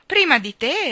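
bow him through, courteously; polite king. Acted. King inviting the stranger through, high and gracious.

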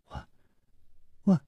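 small, halting stammer; trembling voice. A narrator's reading. Zuo Mingzhu shaking before the real Shiyin, trembling. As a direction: falter on a single word with long gaps. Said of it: "颤声说".

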